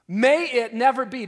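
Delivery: firm emphatic no; preacher repeating the crowd's cry, firmly and loudly; strong denial. Retold or imitated. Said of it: "strongest negative statement you can make".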